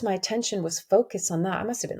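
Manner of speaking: ordinary delivery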